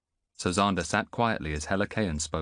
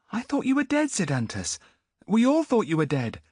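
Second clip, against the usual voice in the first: put-on much higher, louder boy's voice; young boy; 14 semitones higher, 4 dB louder than usual. Boy to Antiochus, high bewildered voice. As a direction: sound lost and bewildered.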